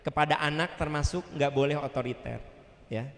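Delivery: normal talking voice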